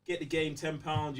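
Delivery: ordinary talk, nothing marked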